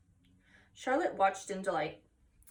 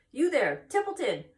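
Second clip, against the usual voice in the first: higher, louder call across the yard; 6 semitones higher, 5 dB louder than usual. Acted. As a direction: call the rat from a distance. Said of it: "he called".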